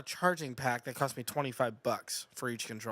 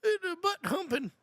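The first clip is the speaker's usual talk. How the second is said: put-on exhausted old guy. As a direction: drag it out like a tired old storyteller. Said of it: "yours sounds like he's, like, very exhausted".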